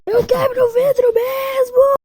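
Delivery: high, crying scream. Acted. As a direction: shriek it, breaking out in panic. Character tries to escape.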